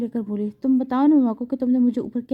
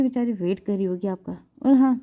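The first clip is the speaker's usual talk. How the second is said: ordinary delivery, nothing marked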